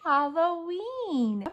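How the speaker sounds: slow loud "Halloween" for the class to echo; slow, drawn-out Halloween. Shown as a demonstration. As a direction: say "Halloween" slowly and loudly, syllable by syllable. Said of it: "Can you say Halloween?".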